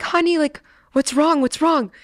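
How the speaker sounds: high, concerned voice imitating a father; worried dad. Dad finding his daughter in tears, imitated worry. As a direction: play an alarmed parent, pitched well up.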